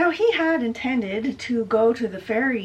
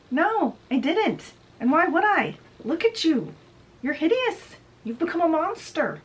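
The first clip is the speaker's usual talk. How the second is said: higher-pitched mock scorn; retold brother jeering at Hades, higher and pushing; scornful Zeus; 9 semitones higher, 3 dB louder than usual. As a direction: sneer the insults at him, pitched up. Zeus insults Hades in quarrel.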